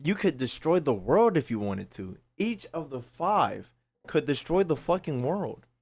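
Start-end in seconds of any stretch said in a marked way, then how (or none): none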